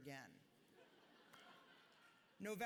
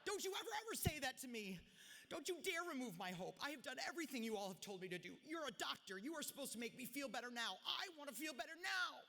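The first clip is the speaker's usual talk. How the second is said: much higher and louder, furious and crying out; tearful yell; 8 semitones higher, 4 dB louder than usual. Retold or imitated. Crying and yelling at the neurologist who took her hope, furious. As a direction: yell it through tears, pushing back hard. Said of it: "I burst into tears and yelled at him".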